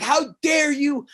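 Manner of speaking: slow, high and stretched, scandalised; indignant outrage. Retold or imitated. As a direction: spit out each word slowly like an offended teacher. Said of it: "she's like".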